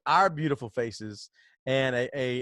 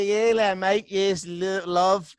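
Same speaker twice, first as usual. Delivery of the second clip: loud, higher-pitched mock Cockney; bad Cockney accent; 5 semitones higher, 4 dB louder than usual. Shown as a demonstration. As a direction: ham up a horrible Cockney attempt. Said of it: "a little Cockney accent here".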